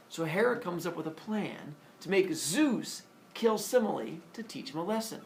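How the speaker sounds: plain reading voice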